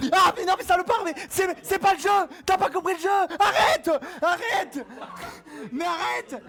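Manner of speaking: loud, high, laughing pleas to stop; shouted protest. Real, not acted. Streamer being tased over and over, high loud shouting. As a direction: shout at them to stop the shocks.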